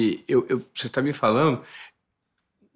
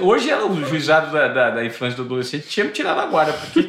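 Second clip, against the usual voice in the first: laughing; 5 semitones higher, 6 dB louder, faster than usual. Real, not acted. Laughing confession about childhood beatings.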